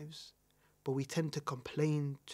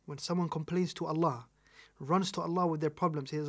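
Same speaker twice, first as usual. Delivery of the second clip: ordinary delivery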